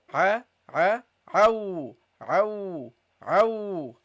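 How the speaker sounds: throaty a and au; teacher drills the letter ain; Arabic ain. Shown as a demonstration. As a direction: squeeze a, au from the throat. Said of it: "This is how you pronounce it".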